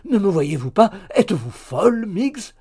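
loud, high-pitched exasperated call. A narrator's reading. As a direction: call up at her, out of patience. Exasperated man calls up to her. (appel exaspéré, fort et aigu)

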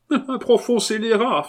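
very high, loud cry; indignant outburst. Acted. Xavier backing up the abbé, high loud outburst. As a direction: denounce him forcefully. Brother denounces the culprit.

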